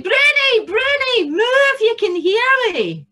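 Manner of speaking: very high, full-voiced holler; shouting for Bruni. A narrator's reading. Tam calls out for his cow, shouting. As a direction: shout it as loud and long as possible. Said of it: "then he shouted".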